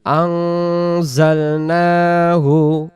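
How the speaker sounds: slow, melodic Quran recitation. Acted. Teacher recites Surah Qadr for the students, melodic. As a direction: recite each phrase slowly in tajweed.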